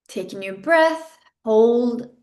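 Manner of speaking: ordinary talk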